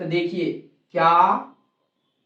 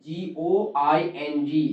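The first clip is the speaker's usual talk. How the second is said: slow letter-by-letter spelling; 6 semitones lower, 6 dB quieter, much slower than usual. Shown as a demonstration. Teacher spelling going for the class, slowly. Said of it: "spelling going letter by letter".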